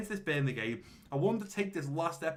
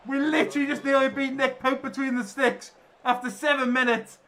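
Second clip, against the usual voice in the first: excited commentary; 9 semitones higher, 7 dB louder than usual. Real, not acted. Near goal in live play.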